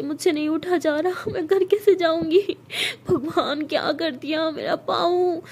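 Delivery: put-on hurt girl cries to her friend, tearful and slow; crying. A narrator's reading. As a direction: sob it out, begging to go home. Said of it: "बहुत रो रही होती है".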